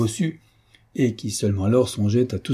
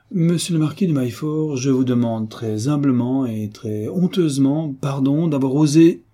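mock-humble apology; 5 semitones higher, 3 dB louder than usual. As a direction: draw out the humiliating apology with mock solemnity. Hunchback dictates a mock humble apology.

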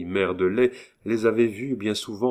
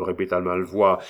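ordinary delivery, nothing marked

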